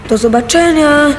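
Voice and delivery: calling to family from a moving train; shouting at full voice. A narrator's reading. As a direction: yell goodbye from the train door. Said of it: "krzyczałem na cały głos".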